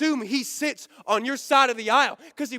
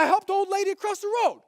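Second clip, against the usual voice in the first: higher, mock-pious self-justifying tone; smug excuse voice; 9 semitones higher, faster than usual. Preacher mocking a churchgoer's excuse, in a higher smug voice. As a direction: play the smug do-gooder making excuses.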